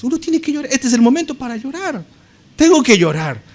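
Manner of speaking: high, insistent acted outburst; indignant protest. Mourner answering the impertinent visitor, indignant and insistent.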